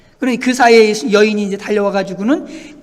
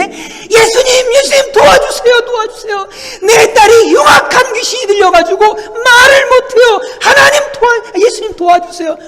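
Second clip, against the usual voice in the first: shouting louder; 13 semitones higher, 7 dB louder than usual. Acted. Ignored mother shouting harder to Jesus for her daughter, desperate cry. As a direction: scream the plea over and over. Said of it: "더 소리 질러야 되겠네".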